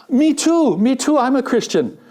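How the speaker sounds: high, eager agreement; eager me too. Retold or imitated. Preacher telling a joke, eagerly agreeing with the jumper. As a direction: chime in eagerly, delighted.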